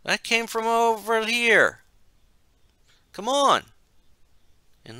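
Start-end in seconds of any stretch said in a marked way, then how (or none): 0.0-3.7 high, loud call to companions; shout. A narrator's reading. Unseen teenager calls friends toward the explosion, high shout. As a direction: call the others over in a high, raised voice. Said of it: "he heard a voice shout".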